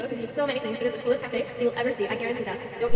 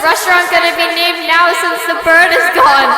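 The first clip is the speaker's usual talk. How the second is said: panicked outcry; 5 semitones higher, 11 dB louder than usual. Acted. Worker panics over stolen bird.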